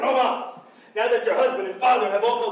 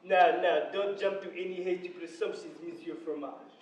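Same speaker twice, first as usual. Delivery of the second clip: low, soft reassurance; quiet, trailing lower and softer; placating; 7 semitones lower, 9 dB quieter than usual. Acted. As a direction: hush an upset husband smoothly. Count placates the shocked husband.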